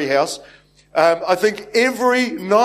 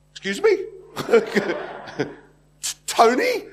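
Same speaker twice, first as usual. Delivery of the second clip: much higher, mock-bewildered Excuse me and Tony; puzzled celebrity; 5 semitones higher, 3 dB quieter than usual. Pastor imitating Anthony Hopkins, baffled, much higher pitch. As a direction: play the baffled star nobody fusses over. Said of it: "And he'd go".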